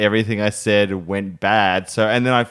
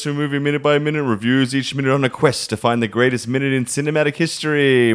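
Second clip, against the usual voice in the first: upbeat announcer read of the show intro; podcast intro; 3 semitones higher than usual. Acted. Host welcoming listeners, upbeat announcer read.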